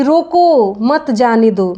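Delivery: long slow "रोको", then "मत जाने दो" as one phrase; pause after रोको. Shown as a demonstration. Someone telling another to stop a person leaving, "रोको" set apart. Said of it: "इसका अर्थ ये हुआ कि उस व्यक्ति को रोक लो".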